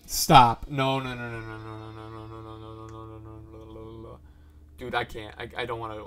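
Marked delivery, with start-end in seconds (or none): 0.1-0.7 sudden very loud cry to stop; alarmed shout. Real, not acted. Viewer yelling at a scary video, alarmed shout. 0.7-4.2 rapid run of refusals, fading to very soft; panicky refusal. Real, not acted. Viewer who can't watch the scary video, panicky fading refusal.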